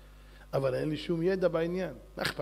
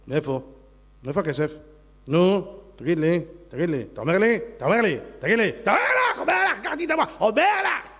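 nagging wife; 5 dB louder than usual. Acted. Wife nagging husband about money.

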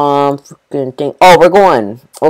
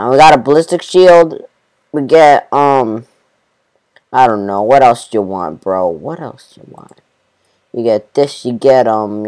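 usual speaking voice

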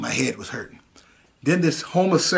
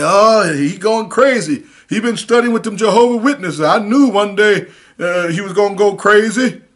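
alarmed family; 6 semitones higher, 5 dB louder than usual. Retold or imitated. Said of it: "they said".

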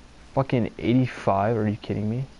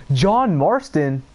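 slow, drawn-out call, louder and higher; 5 semitones higher, 6 dB louder, much slower than usual. Real, not acted. Gamer calling out to John Marston in game, slow loud call. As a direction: stretch the name out like hailing someone.

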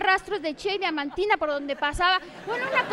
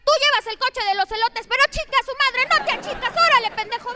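loud, raised driver cursing out another car; road rage yelling; 6 semitones higher, 5 dB louder than usual. Retold or imitated. A driver yelling at another car, loud and raised. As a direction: yell at the driver blocking you.

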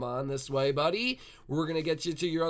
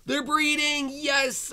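gasp, then high, loud delight; thrilled high-pitched celebration; excited cheer; 12 semitones higher, 5 dB louder, much slower than usual. Real, not acted. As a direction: cry out in triumph, stretching the words. Animals finally breed in game.

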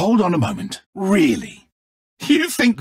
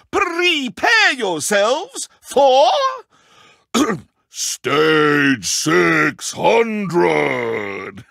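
drawn-out dramatic announcement; much slower than usual. Acted. Host drags out dramatic announcement.

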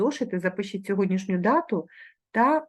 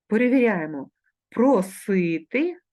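usual speaking voice

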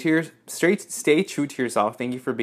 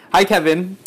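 higher, louder, perky greeting; letter writer's voice; 6 semitones higher, 7 dB louder than usual. Acted. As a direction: lightly voice the fan who wrote. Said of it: "I have to read it in your voice".

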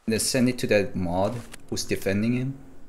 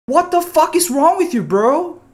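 outraged outburst; 6 dB louder than usual. Real, not acted. Outraged at cheater's hashtags.

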